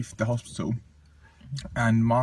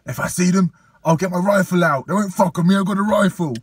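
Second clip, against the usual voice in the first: loud, raised mock threat; uncle's threat; 5 semitones higher, 6 dB louder than usual. Nephew imitating his uncle threatening the attackers, loudly. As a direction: bellow it like a hot-headed uncle. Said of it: "and he goes".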